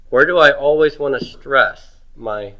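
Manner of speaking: ordinary talk, nothing marked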